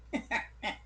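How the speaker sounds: high, breathy evil chuckle; a faint, distant evil laugh. Acted. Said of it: "someone chuckling evilly".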